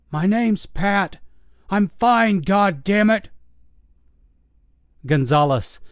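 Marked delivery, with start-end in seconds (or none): 0.1-3.3 put-on high, slow, fed-up old man; exasperated old guard. Old guard snapping at the investigator, exasperated. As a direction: bite off each word in exasperation.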